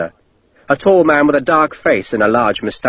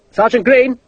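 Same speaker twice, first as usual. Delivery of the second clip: calling out; 10 semitones higher than usual. A narrator's reading. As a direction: call for the sergeant over the noise. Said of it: "Sharp called".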